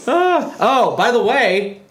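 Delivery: ordinary delivery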